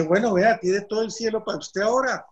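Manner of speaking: high-pitched, insistent retold voice; animated offer. San Pedro offering the paisa all of heaven, animated. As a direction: make a big lively offer.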